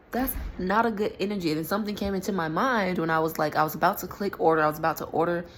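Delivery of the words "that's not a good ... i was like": usual speaking voice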